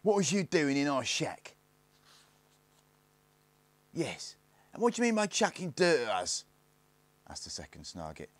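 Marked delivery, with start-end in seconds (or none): 0.0-1.6 raised, higher-pitched challenge; accusing demand. A narrator's reading. Snarget questions the intruder, accusing raised voice. 3.9-6.4 high-pitched indignant demand; accusing. A narrator's reading. Second Snarget accuses Barney of chucking dirt, high indignant voice.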